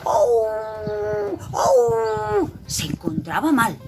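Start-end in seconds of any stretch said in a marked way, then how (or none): 0.1-2.5 loud, drawn-out "au" of bellyache; acted wolfish wail of pain; pained howl. A narrator's reading. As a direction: howl in mock pain, rising high. Wolf's belly starts hurting.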